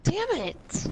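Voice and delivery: high frustrated cry; frustrated outburst. Real, not acted. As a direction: curse the miss. Frustrated after missing.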